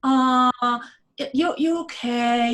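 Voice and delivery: slow, lightly voiced coddling; mock coddling. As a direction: ask it like you're babying them, slowly. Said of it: "to coddle you".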